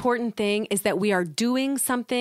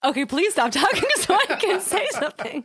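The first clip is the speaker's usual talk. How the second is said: higher, giggling through the words; helpless, amused plea; laughing plea; 8 semitones higher than usual. Real, not acted. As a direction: beg for a turn while laughing.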